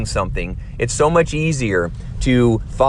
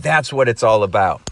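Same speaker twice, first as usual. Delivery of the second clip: ordinary delivery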